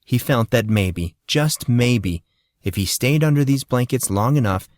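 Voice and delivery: ordinary delivery, nothing marked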